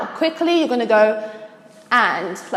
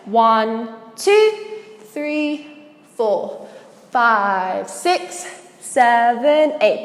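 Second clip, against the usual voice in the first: slow, drawn-out counts with gaps between; counting out beats; much slower than usual. Shown as a demonstration. Dance teacher counting out the poses, slow measured count. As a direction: stretch each number over its beat.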